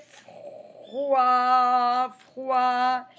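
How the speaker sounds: "froid" slow, with long "fr" and held vowel; sounded out. Shown as a demonstration. Teacher describing the little boy, "froid" drawn out. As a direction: stretch out both takes of "froid".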